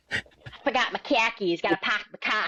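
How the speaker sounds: put-on khakis for car keys in a thick Boston accent. Joking Boston local to a podcast host, in a Boston accent. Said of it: "It's a Boston thing".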